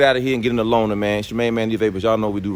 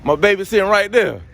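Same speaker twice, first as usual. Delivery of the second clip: higher and louder, hammed-up wailing over the car; mock lament; 9 semitones higher, 5 dB louder than usual. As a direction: wail like a heartbroken parent, half joking. Car owner mock-grieving car in shop.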